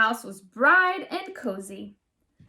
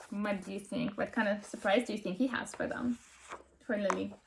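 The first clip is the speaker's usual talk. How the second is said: much softer question to the listeners; quiet aside; 4 semitones lower, 11 dB quieter, faster than usual. Real, not acted. Narrator quietly asks the listening children a question.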